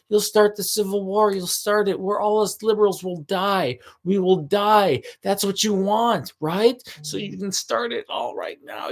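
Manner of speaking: high, fast, needling taunt; mock goading voice. Teasing the man to start a civil war, ending in a shout. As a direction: egg him on, climbing to a yell. Taunting the guy to kill him.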